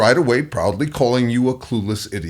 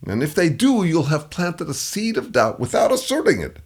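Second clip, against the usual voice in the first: ordinary talk, nothing marked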